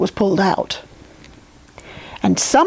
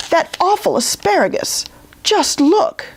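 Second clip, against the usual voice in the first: whiny king; 8 semitones higher than usual. Acted. King complains to queen.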